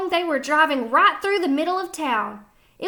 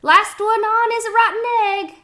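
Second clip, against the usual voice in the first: chicken's thought; 8 semitones higher, 6 dB louder than usual. A narrator's reading. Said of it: "what she thought was".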